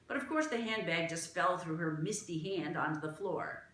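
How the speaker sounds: ordinary delivery, nothing marked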